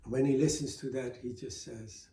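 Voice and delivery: ordinary delivery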